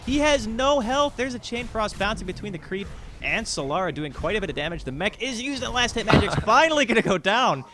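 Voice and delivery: fast, high, loud esports casting; excited play-by-play. Real, not acted. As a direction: call the fight like a hype caster. Live team fight play-by-play.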